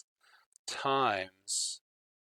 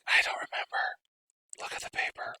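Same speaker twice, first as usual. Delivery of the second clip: whispered inner voice of the brain; the brain hisses advice in a whisper; faster than usual. Retold or imitated. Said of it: "My brain's like".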